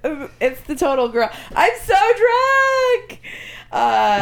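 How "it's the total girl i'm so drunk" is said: put-on high, slow, slurred drunk girl. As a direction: act a sloppy drunk girl. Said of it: "So she said".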